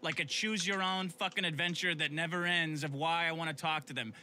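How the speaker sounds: normal talking voice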